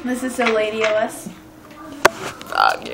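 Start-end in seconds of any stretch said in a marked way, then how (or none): 2.1-2.9 belched, very low and gurgly; burping the words. Pretend. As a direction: push the words out on a burp. Friends burping words for fun.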